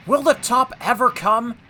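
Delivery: high, loud, anxious effort; straining. A narrator's reading. James labouring uphill, straining anxiously.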